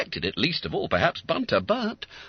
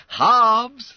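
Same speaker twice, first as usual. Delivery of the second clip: high, loud, gleeful reminder; chuckling; 9 dB louder than usual. Acted. Bounder claiming his share, chuckling. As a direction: call it out with relish. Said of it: "with a chuckle".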